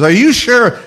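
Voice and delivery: high-pitched imitation of mother's doubt. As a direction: question it as a worried mother, pitch up. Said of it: "My mother says".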